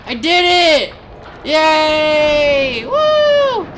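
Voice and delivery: loud, high victory whoop; triumphant cheering. Real, not acted. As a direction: cheer like you just pulled it off. Triumph after dropping the bomb.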